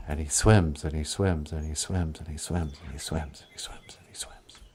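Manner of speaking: low, rhythmic repetition dwindling to a whisper; disappearing into the deep; fading to whisper. A narrator's reading. Long dive, fading to whisper.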